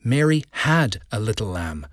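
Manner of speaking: "had" singled out and stretched; emphasize "had" as the one key word; stress on had. Shown as a demonstration. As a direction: lean on "had", hinting it is past. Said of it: "emphasizing just one word".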